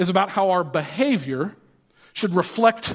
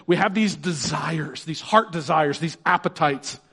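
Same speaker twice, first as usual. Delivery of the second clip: usual speaking voice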